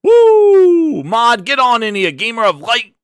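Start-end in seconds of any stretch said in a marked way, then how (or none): 0.0-1.0 excited whoop. Real, not acted. Hype shout opening stream.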